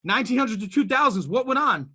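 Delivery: rally the room like a preacher; preaching build. Real, not acted. Speaker rouses listeners about human entrepreneurship, building preaching. Rhetorical history sweep.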